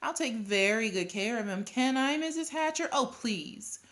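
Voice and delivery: put-on high, pleading kid; eager begging. A narrator's reading. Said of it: "begged some more".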